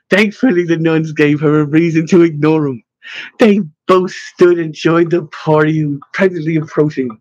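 usual speaking voice

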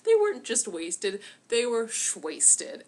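put-on "shwasted" said slowly with a drunk slur; slurred shwasted. Shown as a demonstration.

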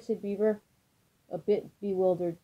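ordinary delivery, nothing marked

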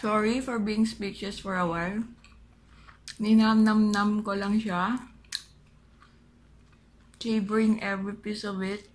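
words around mouthful of food; chewing while talking. Real, not acted. Seen 0: Holds wing, closed-lip chewing motions visible between words throughout clip.

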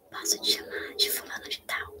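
whispers the awkward question almost inaudibly; hushed and sheepish, barely voiced; embarrassed whisper. Whispered retold risky request. (sussurro envergonhado)

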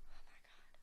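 whispered under the breath; exasperated whisper. Real, not acted. Exasperated aside during game.

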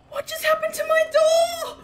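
high, loud shocked shout. Real, not acted. Player shouting at his broken door in shock. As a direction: yell it in disbelief.

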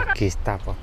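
low, hushed voice, creeping up on him; quiet muttered aside while spying on a friend; hushed sneaky aside. Acted. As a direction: keep it under your breath so he won't hear.

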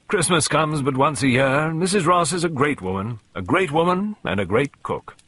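jovial, booming praise; hearty Colonel. A narrator's reading. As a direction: proclaim it like a genial old soldier.

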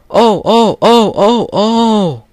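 high, loud squealing trucks; screaming trucks. Acted. Trucks jolted by Edward's shunting, shrill squealing scream. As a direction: squeal like startled toy trucks. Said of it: "screamed the trucks".

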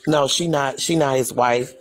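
usual speaking voice

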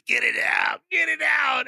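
high mock cry, climbing on the repeat; retold yelling. Podcaster retells man with gold in stomach, high mock yell. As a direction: imitate a panicked yell, pitched well up.

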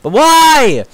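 high, loud wail of disbelief; baffled shriek. Real, not acted. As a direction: squeal the question out. Disbelief at viewer count.

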